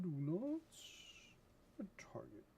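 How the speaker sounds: mock the game's error voice; teasing echo fading into whisper; mimicking game voice. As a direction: start high, drop to a whisper.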